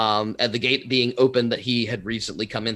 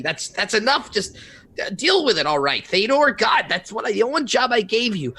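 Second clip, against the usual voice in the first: higher, impatient scolding of a servant; Savik scolding; 9 semitones higher than usual. Acted. Savik scolds Thedor for failing his one job. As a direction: brush him off impatiently.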